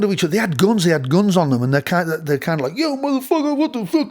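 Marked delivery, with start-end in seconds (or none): 2.8-4.1 high-pitched imitation of swaggering rappers; mock gangsta rapper shtick. Guitarist retelling how the visiting band talked, mock rapper shtick. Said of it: "They're doing that whole shtick".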